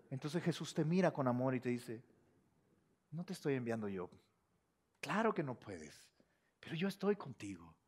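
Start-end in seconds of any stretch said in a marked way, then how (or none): 3.1-7.7 low, soft and gentle; quiet loving reassurance; tender Jesus. Acted. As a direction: comfort a discouraged believer.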